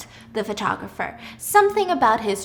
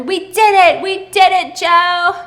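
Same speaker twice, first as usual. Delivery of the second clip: higher and louder victory shout; triumphant cheer; 7 semitones higher, 8 dB louder, slower than usual. Retold or imitated. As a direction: celebrate wildly together. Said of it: "screaming at each other".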